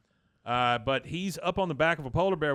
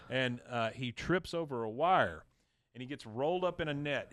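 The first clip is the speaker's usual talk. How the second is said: ordinary delivery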